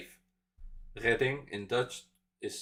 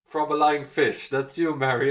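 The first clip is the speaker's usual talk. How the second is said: loud mocking jab; teasing; 5 semitones higher, 8 dB louder than usual. Real, not acted. Teasing Mary about her lying fish, loudly. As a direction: poke fun at her, loudly.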